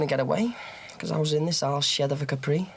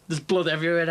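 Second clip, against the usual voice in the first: usual speaking voice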